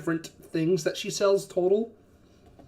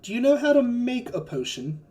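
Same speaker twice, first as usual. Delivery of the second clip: higher-pitched questioning shopkeeper; skeptical herb seller; 7 semitones higher than usual. Acted. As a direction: quiz the customer as a doubtful seller. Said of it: "looks at you and is like".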